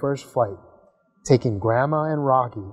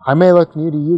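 louder and higher, puffed up and boastful; boastful rocket; 4 semitones higher, 7 dB louder, faster than usual. Acted. Big rocket Zor brags to little Gus, puffed up. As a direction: lord it over the little one.

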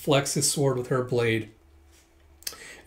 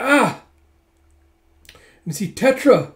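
loud, higher exclaiming; excited gasp; 9 semitones higher, 8 dB louder than usual. Real, not acted. Narrator gasping as Tetra is attacked, loud and excited. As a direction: blurt out the shock.

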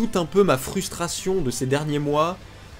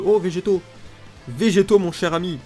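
excited; 5 semitones higher than usual. Real, not acted. Pulls a wanted card.

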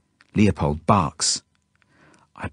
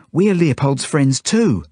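higher, louder insistence; urgent appeal; 6 semitones higher, 5 dB louder than usual. A narrator's reading. Felix to the kid with the knife, urgent appeal. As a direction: plead your case forcefully.

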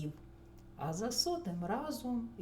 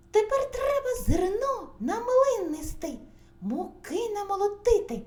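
high, loud squeaky chorus voice; squealing mice; 10 semitones higher, 10 dB louder than usual. A narrator's reading. Mice tell rooster to visit the mill, squealing. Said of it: "запищали в один голос".

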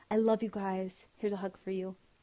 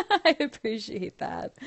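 laughing thanks; 4 semitones higher, 9 dB louder, slower than usual. Real, not acted. Thanked by viewers for mobbing.

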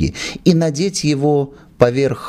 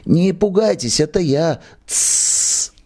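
nasal whisper; 7 semitones higher than usual. Acted. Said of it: "В гнусавом шёпоте".

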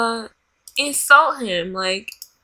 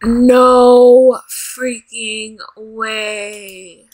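loud, very drawn-out disbelief; shocked disbelief; 2 semitones lower, 4 dB louder, much slower than usual. Real, not acted. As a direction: stretch it out slowly in shock. Shocked reaction to game betrayal.

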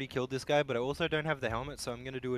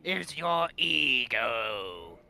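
slow villain voice; slower than usual. Pretend. Villain line cracking up.

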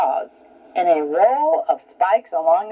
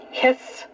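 hiss; 6 semitones higher, 4 dB quieter than usual. Shown as a demonstration. Reading cats hiss when mad.

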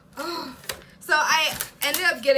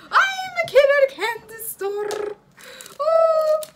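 high, loud giddy excitement; overjoyed fan squeal; thrilled squeal; 14 semitones higher, 8 dB louder than usual. Real, not acted. Squealing over photo cards.

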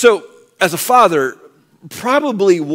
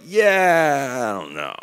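much lower, softer, unconvinced mumble; skeptical shrug; 3 semitones lower, 4 dB quieter, much slower than usual. Retold or imitated. James brushing off news of his brother's miracle, low doubtful mumble. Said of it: "And James is like".

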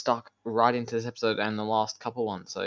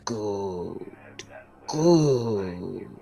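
lightly voiced villain, second good louder; sinister Sith lord; 3 dB louder than usual. Dark lord praising his kneeling apprentice, lightly sinister. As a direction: savour the word, a touch of menace.

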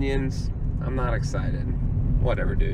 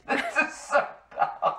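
laughing. Real, not acted. Laughing at own silly song.

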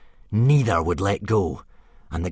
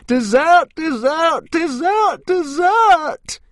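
piercing, much higher, louder dragon shriek; 7 dB louder, slower than usual. Acted. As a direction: screech it out like a greedy little dragon. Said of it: "she shrieked".

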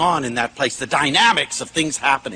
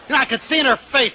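ordinary talk

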